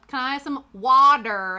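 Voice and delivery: "water" as a loud, hard North American WAH-der. Retold or imitated. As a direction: overdo the D and final r in "water". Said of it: "he had to say water with a D".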